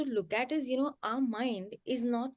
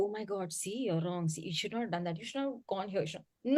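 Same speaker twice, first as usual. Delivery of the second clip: a hushed, scolding inner voice, a lot softer; judging inner voice; 3 semitones lower, 7 dB quieter than usual. Retold or imitated. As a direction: mutter the blame at yourself, softly. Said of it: "it doesn't want to tell you".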